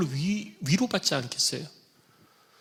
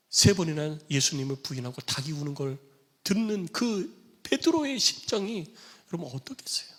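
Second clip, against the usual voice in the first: usual speaking voice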